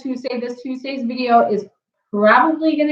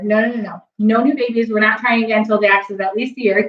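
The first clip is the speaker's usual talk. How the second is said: ordinary talk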